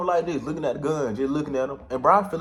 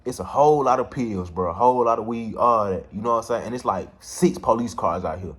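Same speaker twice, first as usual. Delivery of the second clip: usual speaking voice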